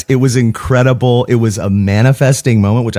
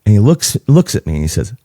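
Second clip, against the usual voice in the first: ordinary talk, nothing marked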